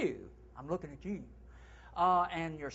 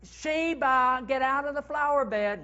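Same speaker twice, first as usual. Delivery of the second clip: much higher, loud call across the garden; calling to the dog; 9 semitones higher, 9 dB louder than usual. Pretend. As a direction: yell at the dog in the flowers. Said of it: "like you're calling to the dog".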